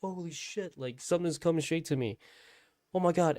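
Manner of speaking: high-pitched re-enacted dread; retold fright. Storyteller backing away from the thing in the dream, acted fright. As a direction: back away in acted alarm, voice jumping up. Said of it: "I was fucking scared".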